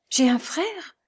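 high, rising astonished question; amazed exclamation. A narrator's reading. Lucie clasping hands at news of a brother, amazed. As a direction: ask it in joyful disbelief. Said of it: "s'écria Lucie en joignant les mains".

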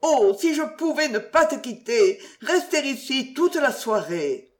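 high, yearning lament; long to stay, with understated sadness; wistful melancholy. A narrator's reading. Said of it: "des accents d'une indéfinissable mélancolie". (mélancolie tendre, voix haut perchée)

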